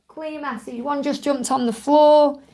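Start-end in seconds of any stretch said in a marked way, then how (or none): 0.1-0.8 "Clear message" repeated much softer, almost under the breath; soft echo. Shown as a demonstration. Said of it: "That's more like it".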